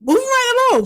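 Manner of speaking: high-pitched, impatient mock brush-off; hammed-up unsympathetic comeback; retold dismissive girlfriend. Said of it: "She was not trying to hear it".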